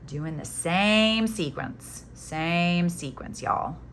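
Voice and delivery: slow, stretched Southern vowels; Southern accent. Real, not acted. Crafter telling y'all to repeat the sequence, Southern accent slipping out. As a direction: let the Southern out, drawing out SAME. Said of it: "My Southern just came out".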